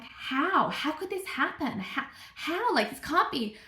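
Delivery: high-pitched retold disbelief; incredulous. Mother-to-be reacting to pregnancy news, high incredulous questioning. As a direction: repeat it baffled, pitch jumping up.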